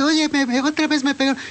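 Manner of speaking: voz de niño mucho más aguda, lloriqueo fingido; niño lloriqueando imitado. Retold or imitated. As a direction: imita al niño que vuelve llorando. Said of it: "Y regresa otra vez llorando". (el niño vuelve llorando con su mamá, voz aguda de lloriqueo)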